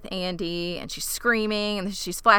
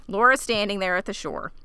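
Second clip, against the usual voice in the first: ordinary delivery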